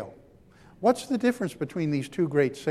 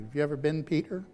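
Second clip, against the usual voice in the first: ordinary talk, nothing marked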